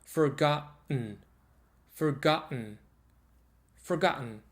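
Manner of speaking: "forgotten" with the double T as a glottal stop straight into n; slow, deliberate "Forgotten" with a throat catch. Shown as a demonstration. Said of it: "double T is actually the glottal stop".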